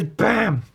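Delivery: loud, high "bam"; crash sound effect. Retold or imitated. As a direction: punch out a comic-book impact. Voices the crash.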